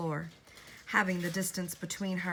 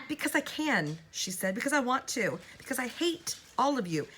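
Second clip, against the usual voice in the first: higher, hateful, stressed I; hateful declaration; 7 semitones higher, 3 dB louder than usual. A narrator's reading. Woman declares her hatred to Elizabeth, high and fierce.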